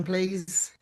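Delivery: ordinary delivery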